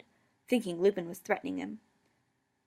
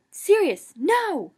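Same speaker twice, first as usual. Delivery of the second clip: much higher, louder alarmed cry; 11 semitones higher, 11 dB louder, much slower than usual. A narrator's reading. Remus crying out to Sirius to stop, much louder. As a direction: shout to stop him. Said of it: "even as Remus cried".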